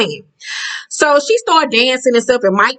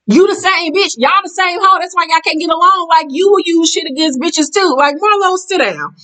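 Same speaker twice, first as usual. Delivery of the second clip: heated rant; 8 semitones higher than usual. Real, not acted. Vlogger calling out Marlo and Toya, heated. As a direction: go off on them. Ranting at castmates.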